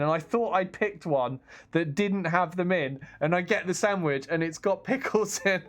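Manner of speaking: ordinary talk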